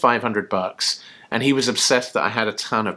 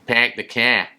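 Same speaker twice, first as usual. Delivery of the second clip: "Pack the car." with a lightly imitated Boston accent, "car" said "kehr"; a hint of a Boston accent on "Pack the car.". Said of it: "impossible to do an impression of".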